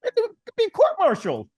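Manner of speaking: lecturing the hero about his parents, high mock voice; Lundgren speech impression. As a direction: ham it up like the unhinged sergeant. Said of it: "you know".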